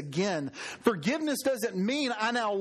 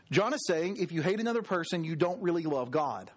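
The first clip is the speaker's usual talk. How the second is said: normal talking voice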